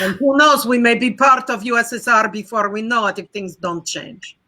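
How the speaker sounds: put-on deadpan Soviet warning in a Russian accent; playful Russian accent. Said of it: "You can talk like this from mother country".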